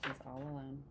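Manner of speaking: ordinary delivery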